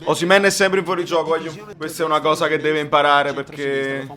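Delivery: ordinary delivery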